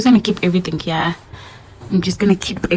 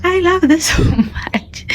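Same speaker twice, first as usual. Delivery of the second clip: delighted laugh; 11 semitones higher, 4 dB louder, slower than usual. Real, not acted. Delighted over the gift.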